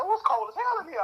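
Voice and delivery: high-pitched retold comeback; imitated reply. Reply in the cool car, imitated in a high voice.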